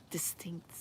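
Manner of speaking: breathy whispered word. Real, not acted. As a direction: drop to a hushed whisper.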